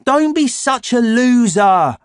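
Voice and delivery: slow, high, loud scoffing; mocking. A narrator's reading. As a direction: taunt a scared friend. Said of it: "he said".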